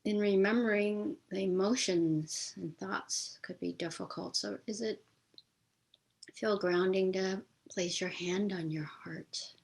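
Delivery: measured meditation guide with long pauses; guided meditation. Real, not acted. Teacher leads listeners in a memorial meditation for George Floyd, guided meditation voice. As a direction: guide listeners gently, leaving space between phrases.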